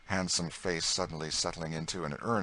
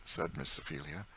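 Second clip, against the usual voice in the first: ordinary delivery, nothing marked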